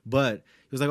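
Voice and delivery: ordinary talk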